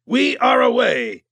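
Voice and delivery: high, slow, drawn-out announcement; Kat over the comm. A narrator's reading. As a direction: call it out slowly as Kat. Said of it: "Kat said over the comm".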